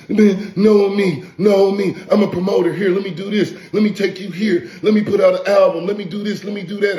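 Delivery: high, whiny mock of a begging wannabe; high mocking baby whine. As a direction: whine it up high like a needy kid. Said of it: "You was like a little baby".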